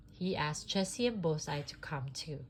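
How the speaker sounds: plain reading voice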